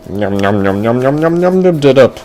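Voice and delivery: run-together munching that climbs in pitch; munch the words in one rising run; gobbling noises. Acted. Boy eats the candy, gobbled nom-nom noises.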